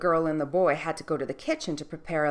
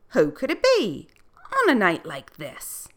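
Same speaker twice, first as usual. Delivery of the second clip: surprised; 4 semitones higher, 6 dB louder than usual. Acted. Doorman startled by knock.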